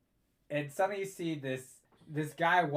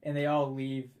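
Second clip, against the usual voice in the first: ordinary delivery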